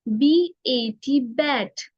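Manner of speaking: बी ए टी spelled out slowly, letter by letter, then बैट; slow teacher spelling; slow spelling demo. Shown as a demonstration. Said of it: "हम लोग बोलेंगे बी ए टी बैट".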